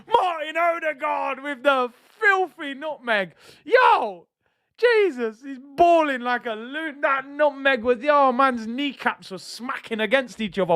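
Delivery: yelling with pure joy at the skill; excited outburst. Real, not acted. Streamer hyped by Odegaard's nutmeg, excited shout. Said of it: "sorry for my excitement at the Odegaard nutmeg".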